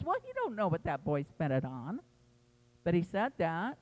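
ordinary delivery

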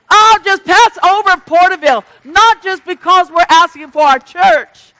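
loud, high, driving preacher's cry; preaching at full force. Acted. Preacher rousing the congregation, loud fervent preaching. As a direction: lift the church with a rising prayer.

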